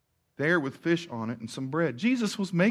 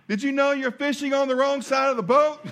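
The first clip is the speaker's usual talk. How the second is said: teasing Jesus; 8 semitones higher than usual. Retold or imitated. Jesus voiced teasing the fishermen.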